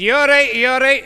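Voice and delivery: loud, high call of concern. Retold or imitated. Narrator calls to man lying in gutter, loud concern.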